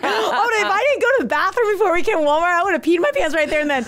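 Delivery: high, laughing confession. Real, not acted. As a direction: crack up while telling it. Laughing through her admission of fear.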